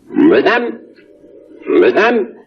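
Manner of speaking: French "madame", rising tune, stress on the second syllable; French accent. Shown as a demonstration. Reversed tape of an Englishman addressing a lady, French-accented "madame".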